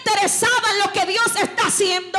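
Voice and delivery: loud, high preacher's cry; impassioned revival sermon; fiery preaching. Real, not acted. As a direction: lift the church to its feet. Preaching at full force.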